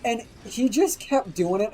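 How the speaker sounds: normal talking voice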